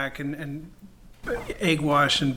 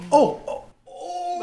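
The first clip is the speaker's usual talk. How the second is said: excited; 6 dB louder, much slower than usual. Real, not acted. Excited about Korean army stew.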